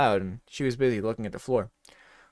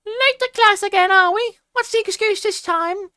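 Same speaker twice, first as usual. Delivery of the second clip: high, loud mocking teacher; stern teacher; 9 dB louder than usual. A narrator's reading. Teacher scolding a late student, high mocking voice. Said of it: "Missus Banger ... said".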